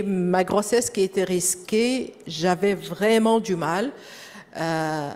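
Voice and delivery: normal talking voice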